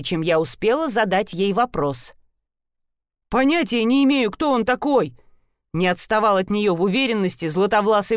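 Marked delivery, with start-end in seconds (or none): 3.3-5.2 горячо отпираясь. Acted.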